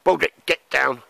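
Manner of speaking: sharp call to the dog. Real, not acted. Calling the dog down off the hammock, loud and high.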